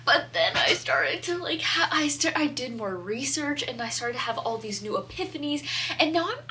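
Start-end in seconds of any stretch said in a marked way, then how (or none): none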